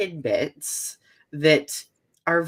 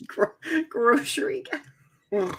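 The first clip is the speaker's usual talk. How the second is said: mock echo; 11 semitones higher than usual. Cohost echoes in mock accent.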